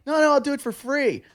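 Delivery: high-pitched stripper voice at normal volume; imitated stripper. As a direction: pitch it way up to mimic an eager offer. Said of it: "And they'd be like".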